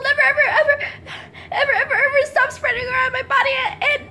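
high, rushed, whiny puppy begging; pleading young dog. Acted. Charlie the dog begging the judge for mercy, high and rushed. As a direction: beg the judge like a desperate little dog, half laughing.

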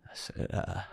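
high, breathy, breaking voice; father near tears repeating his son's words; choked up. Real, not acted. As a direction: barely get the words out through tears. Breaking down recalling son.

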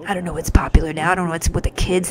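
ordinary talk, nothing marked

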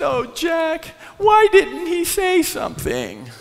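high, loud plea for Jack; hammed-up upset woman; mimicking her distressed cry; quoting his employee. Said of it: "And she said".

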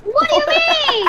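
high, loud outraged yell; indignant shout. Real, not acted. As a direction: shout it in disbelief. Outburst at teammate.

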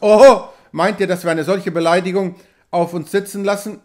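offended trapper flaring up; indignant outburst. A narrator's reading. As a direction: bristle at the insult. Knox indignant at insult.

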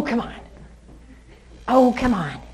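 ordinary talk

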